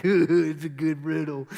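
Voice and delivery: loud, goofy mock voice with a chuckle; goofy self-satisfied chuckler. Preacher mocking Samson over his dumb riddle, goofy and loud. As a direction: mimic someone chuckling at his own cleverness.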